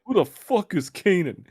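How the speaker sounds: high, loud indignant heckle; angry heckle. Acted. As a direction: shout it from the crowd, offended. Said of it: "One of them goes like".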